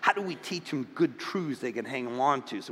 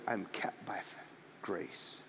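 fading quiet; 7 semitones lower, 14 dB quieter than usual. Real, not acted. Fading to a whisper.

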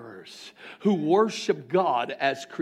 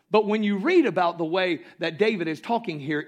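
ordinary delivery

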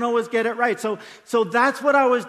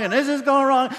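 even higher, louder exasperated whine on going wrong; escalating mock complaint; 6 semitones higher, 5 dB louder than usual. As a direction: push the grumbling one notch further. Complaint builds on repeat.

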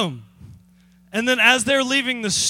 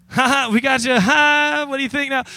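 loud mocking laugh, higher; gloating laugh; 5 semitones higher, 6 dB louder than usual. Prankster taunting fooled influencers, loud mocking laughter. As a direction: crow over the fooled buyers with a laugh.

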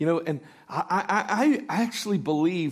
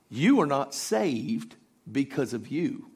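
normal talking voice

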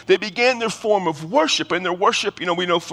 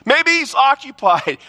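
higher, taunting jeer; mocking trash talk; 8 semitones higher than usual. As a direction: needle the prophets of Baal with a grin. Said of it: "He's egging him on".

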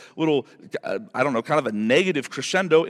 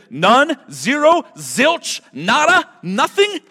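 excited; 7 semitones higher, 6 dB louder, slower than usual. Real, not acted. Building list of nothing.

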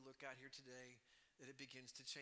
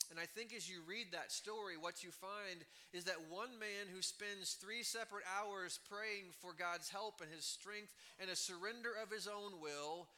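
raised, higher-pitched sermon voice; impassioned preaching; 5 semitones higher, 3 dB louder than usual. Real, not acted. Preacher holds up Jesus's victory to congregation, impassioned. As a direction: drive through the list with rising conviction.

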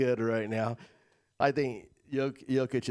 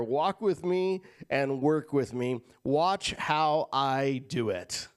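slow savoring reading; slower than usual. Acted. Rereading verse slower.

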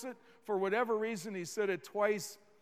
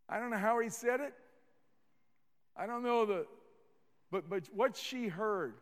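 ordinary talk

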